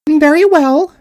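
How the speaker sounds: high, tiny voice with royal pride; a little queen drawing herself up; proud mouse queen. Acted. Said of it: "said the Queen".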